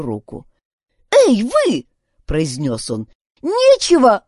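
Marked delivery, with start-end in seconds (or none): 1.1-1.9 high, loud protest; calling out sharply. A narrator's reading. Boy grabs the old woman's arm, calling out. Boy calls out to stop attacker. 3.4-4.2 high, loud, cut-off objection; sharp protest. A narrator's reading. Boy protests to the old woman, loudly.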